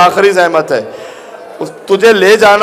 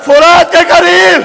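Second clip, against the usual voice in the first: impassioned majlis preaching; 9 semitones higher than usual. Acted. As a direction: build the grief into a wailing, slow call. Rising mourning recitation.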